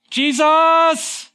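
high, loud shout with a put-on voice; yelling. Healed man glorifying God with a loud voice, yelling. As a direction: cry out at the top of your lungs. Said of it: "he's yelling. He's shouting at the top".

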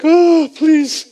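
high, pitiful mock wail; mock crying plea. Preacher mocks the wrong idea of crying, whimpering plea. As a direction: plead in a loud, high, put-on cry. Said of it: "followed him crying ... That's not like".

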